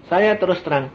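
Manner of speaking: ordinary talk, nothing marked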